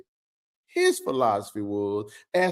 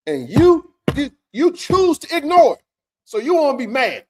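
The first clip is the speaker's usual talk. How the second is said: higher, louder exasperated outburst; frustrated, raised voice at the commenters; exasperated rant; 8 semitones higher, 5 dB louder than usual. Real, not acted. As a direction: push it up in frustration at them.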